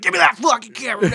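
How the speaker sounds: high, loud surfer-dude yell; angry surfer dude. Retold or imitated. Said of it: "talks like a surfer dude".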